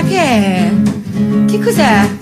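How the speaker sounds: ordinary talk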